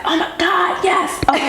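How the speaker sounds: high, giddy re-enacted outburst with laughter; thrilled. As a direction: relive the delighted reaction to big news. Thrilled reaction to father's news.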